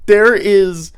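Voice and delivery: ordinary delivery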